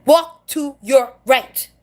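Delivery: slow, separated words; very slow, deliberate delivery; emphatic instruction. Acted. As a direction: tell newcomers one word at a time. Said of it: "we have to start telling them".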